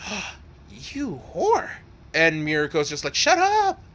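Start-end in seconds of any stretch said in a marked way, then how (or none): 0.1-1.7 slow, high, breathy mock shock; airy, pitched-up scandal; scandalized teasing. Friend's scandalized reaction, acted. 3.1-3.8 very high squealed retort; acted high-pitched protest; embarrassed yell. Embarrassed friend yells back.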